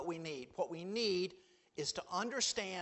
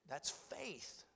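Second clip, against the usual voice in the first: quiet echo; 3 semitones lower, 11 dB quieter than usual. Shown as a demonstration. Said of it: "repeated for emphasis".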